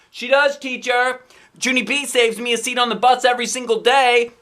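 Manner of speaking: high, loud reply across the room; shouting back. Acted. Friend shouting to teacher across room, high loud. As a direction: back up your friend loudly. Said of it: "shouted back".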